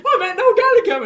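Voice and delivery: very high, loud shrieked with laughter; excited shriek. Retold or imitated. Telling his friend about meeting [name], shrieking excitedly. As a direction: shriek it like a starstruck fan. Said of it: "run around and go".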